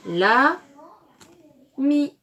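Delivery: "la", pause, "mi", both loud; syllable by syllable. Shown as a demonstration. Teacher assembles syllables for pupils, "la" and "mi" loudly split. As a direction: give "la" and "mi" as separate blocks. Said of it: "Et donc nous avons".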